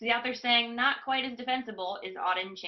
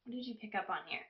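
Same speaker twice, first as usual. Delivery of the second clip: soft repeat; 10 dB quieter, faster than usual. Real, not acted. Teacher gently re-asks a quiet class. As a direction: ease off and ask it again gently.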